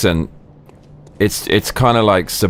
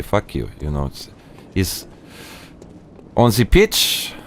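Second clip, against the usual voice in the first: put-on halting foreign-accented manager, often higher-pitched; foreign football manager; 4 semitones higher, slower than usual. Manager praising players and dismissing Wenger, foreign-accented football interview. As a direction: play a football boss in imperfect English.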